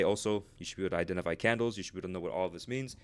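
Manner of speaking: rushed, fast delivery; talking fast. Real, not acted. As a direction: rattle it off quickly. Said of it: "talking super fast".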